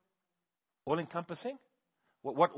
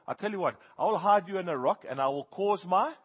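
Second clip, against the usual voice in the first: higher and louder, booming promise; voice of God; 7 semitones higher, 11 dB louder than usual. Retold or imitated. God answering Moses, loud booming promise. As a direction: proclaim it like a promise from above. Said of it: "And God says".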